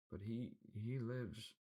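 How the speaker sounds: high, slow, frightened stammer; stuttering servant. A narrator's reading. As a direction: stammer it out fearfully. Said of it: "stuttering man".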